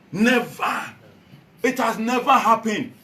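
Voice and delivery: loud, high-pitched declaration; real impassioned outburst of denial; emphatic denial. Real, not acted. Emphatic denial in a political speech.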